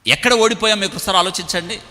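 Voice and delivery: high, forceful preacher's appeal; preaching appeal. Real, not acted. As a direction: press the congregation to look back, voice lifted. Altar call after the sermon.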